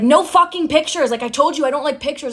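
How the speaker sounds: imitating an angry yell, high and loud at first; mock outburst that eases off after the first burst; retold scream. Boyfriend refusing to be photographed by girlfriend, mock screaming. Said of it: "He would scream at me".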